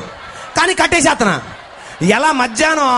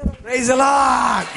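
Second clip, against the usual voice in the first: usual speaking voice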